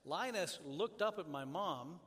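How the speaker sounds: ordinary talk, nothing marked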